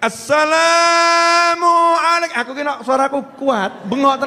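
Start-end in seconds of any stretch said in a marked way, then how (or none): 0.0-2.4 slow, high, drawn-out loud call; calling out to the Prophet. Shown as a demonstration. Preacher shows the women how to call the Prophet, long and loud. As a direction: stretch the greeting out like a cry across a crowd. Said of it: "Panggil nabinya".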